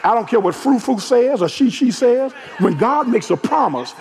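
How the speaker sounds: high, shouted preacher's build, slowing hard on the final line; preaching. Acted. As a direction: shout it to the house and stretch the last words out. Preaching at full force.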